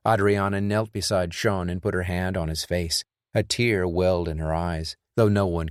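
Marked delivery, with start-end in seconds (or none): none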